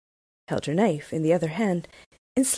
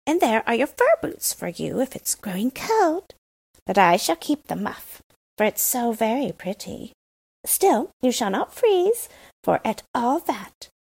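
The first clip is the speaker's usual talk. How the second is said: put-on a lightly raised, bold robber girl voice; brash young robber girl, a touch higher; 6 semitones higher than usual. A narrator's reading. Robber girl gives Gerda boots.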